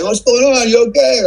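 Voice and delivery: high, lightly voiced country boys asking; imitating the country boys arriving at the crash, high and concerned; concerned locals. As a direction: call it out like curious neighbours. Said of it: "they're like".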